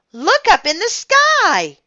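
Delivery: high, loud cry of amazement; excited high yell; shouting. A narrator's reading. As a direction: shout for everyone to look. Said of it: "Andrea shouted".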